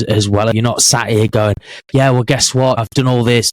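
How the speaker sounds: ordinary delivery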